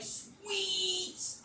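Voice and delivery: high, drawn-out call of the name; exasperated pleading. Retold or imitated. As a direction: stretch it into a pleading whine. Pleading with daughter in retold quarrel.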